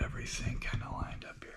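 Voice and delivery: breathy murmur to himself while arranging gear; whisper. Real, not acted. Lifter whispering to himself while lining up his gear. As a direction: whisper it under your breath. Setting up gear, talking to self.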